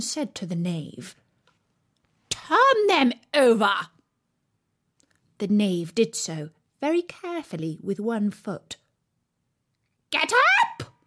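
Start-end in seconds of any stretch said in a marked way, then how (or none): 2.3-3.9 loud, high, slow royal command; imperious queen. A narrator's reading. Queen ordering the knave, loud and imperious. 10.1-10.9 very high, loud shriek; shrill loud queen. A narrator's reading. Queen shrieking at the gardeners to rise. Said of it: "in a shrill, loud voice".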